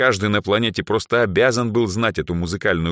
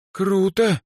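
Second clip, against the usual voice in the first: high, envious exclamation; impressed, wistful high voice; envious awe; 14 semitones higher than usual. A narrator's reading. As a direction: exhale it with a note of envy. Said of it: "с нотками зависти выдохнула Эльза".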